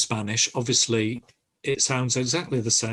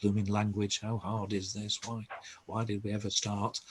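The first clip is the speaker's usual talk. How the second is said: mock grumbling under the breath; chunnering to oneself; 8 dB quieter than usual. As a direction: softly pretend to moan away to yourself. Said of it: "chunnering away to yourselves".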